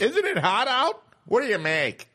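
hammed-up clueless comic shouting; shouting out things. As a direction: holler it at an imaginary crowd. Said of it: "Just shouting out things".

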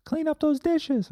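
a pushy little number nagging you to get up; high-pitched nag from the number forty-four. Acted. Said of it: "That's what forty-four does".

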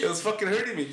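normal talking voice

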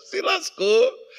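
high, slow, laughing jeer; laughing gloat. Real, not acted. Pastor gloating over Satan with a laugh. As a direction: laugh at the devil's defeat.

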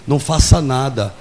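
usual speaking voice

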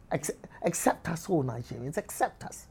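hushed, half-whispered plea; small quiet begging voice, played for laughs; mock pleading. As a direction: beg softly to be let in. Pleads with Nigerians for acceptance.

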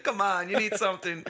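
high, loud coaxing hawker; pushy vendor. Retold or imitated. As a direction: coax the tourist. Vendor voiced in story.

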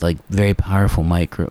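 normal talking voice

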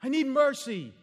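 high, loud, desperate cry for mercy; screaming plea. Acted. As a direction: cry out as a blind man begging to be heard. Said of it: "He's just screaming this".

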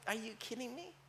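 very soft, understated disbelief; incredulous God. Acted. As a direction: drop to a hushed, mildly incredulous reply. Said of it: "And God's like".

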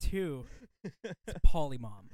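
slow, loud Polly Mom correcting; slow corrective emphasis. Real, not acted. Cohost correcting the name of his mom, slow and loud. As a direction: spell out the name slowly and firmly.

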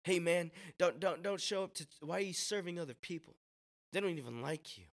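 high, dismissive pal voice; discouraging friend. Acted. Friend talking someone out of serving, high dismissive voice. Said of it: "not push us further from Him".

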